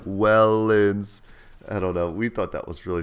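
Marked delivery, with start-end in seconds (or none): 0.0-1.2 "Wellens" stretched long and loud; mock sounding-out. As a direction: draw out "Wellens" in someone's face. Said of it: "got all in her face and was like".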